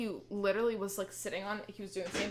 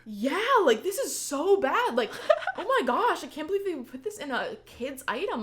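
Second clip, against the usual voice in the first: high, loud mock outrage; hammed-up horror, barely holding back laughter; retold fake alarm; 8 semitones higher, 6 dB louder than usual. Big sister tricking her sister about ink, mock horrified. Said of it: "I also love playing tricks".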